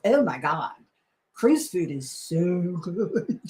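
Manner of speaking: put-on slow gushing Valley girl drawl. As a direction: gush about the food like a Valley girl. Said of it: "I just sounded like a Valley girl".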